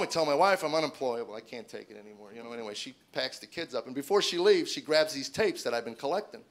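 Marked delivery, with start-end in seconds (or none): none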